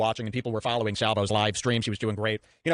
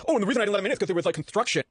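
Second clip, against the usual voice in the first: mocking excuse; 8 semitones higher, faster than usual.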